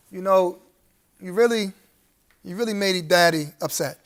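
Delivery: softly, low, play-acting an upset dad; lightly acted fatherly scolding, low and subdued; disappointed daddy act. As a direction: put on a mildly reproachful parent act. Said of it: "I'm just playing a role".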